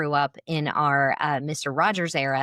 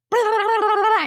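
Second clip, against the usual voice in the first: high, loud excited blah-blah; gushing chatter; 7 dB louder than usual. Retold or imitated. Gushing to a friend about self-compassion, high excited babble.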